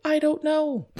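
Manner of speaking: confused guest answering the host; baffled reply. Pretend. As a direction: answer lost and bewildered.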